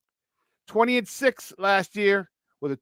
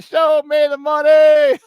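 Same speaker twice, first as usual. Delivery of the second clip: loud, high, slow shouted catchphrase; Jerry Maguire voice; 9 semitones higher, 11 dB louder, slower than usual. Retold or imitated. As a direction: belt it out like Jerry Maguire hyping the deal. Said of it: "in the Show Me the Money voice".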